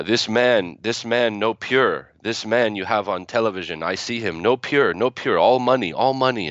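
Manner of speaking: a grandmother's Arabic accent, gently mimicked, scolding; broken English. Grandmother scolding Uncle Benny at the family gathering, mild Arabic-accented broken English. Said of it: "in her broken English".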